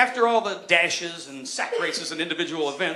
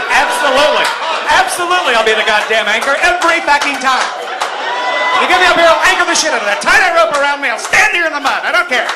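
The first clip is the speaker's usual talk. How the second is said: put-on much louder, brash tough-kid bellow; gung-ho jock voice; 7 semitones higher, 11 dB louder, faster than usual. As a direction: boast like a fearless anchorman. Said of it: "with that voice too".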